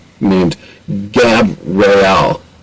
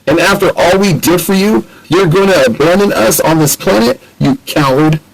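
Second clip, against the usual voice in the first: accusing; 8 semitones higher than usual. Acted. Sydney accuses Ratchet of abandoning them.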